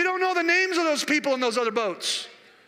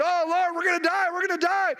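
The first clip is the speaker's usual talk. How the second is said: mock panic, pitched high; hammed-up frightened wail on one high note; freaking out; 6 semitones higher than usual. Disciples crying to Jesus in the storm, mock panic. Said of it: "all the other disciples are freaking out".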